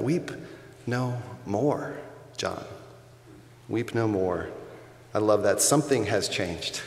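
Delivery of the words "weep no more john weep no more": tender comfort. Acted. Elder comforting John, repeated softly.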